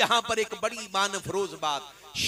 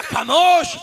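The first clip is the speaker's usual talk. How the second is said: higher, louder single-word rebuke; commanding hush; 6 semitones higher, 8 dB louder, much slower than usual. Acted. God silencing Moses's plea, commanding. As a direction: shut the request down firmly.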